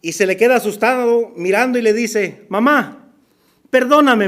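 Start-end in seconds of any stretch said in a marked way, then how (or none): 2.5-4.3 put-on scared child's plea, louder on perdóname; a frightened boy begging forgiveness; child begging mama. Caught son pleading to his mother, louder frightened plea.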